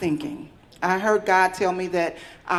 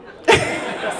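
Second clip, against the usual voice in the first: breathy laugh; 10 semitones higher than usual. Real, not acted. Laughs at own slip of words.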